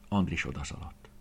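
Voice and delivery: ordinary delivery, nothing marked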